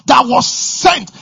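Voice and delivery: loud, high, rousing preacher voice; preaching at full force. Acted. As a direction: drive it home to the congregation.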